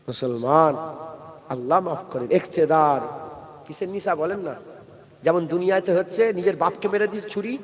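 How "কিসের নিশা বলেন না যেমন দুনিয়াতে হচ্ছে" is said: normal talking voice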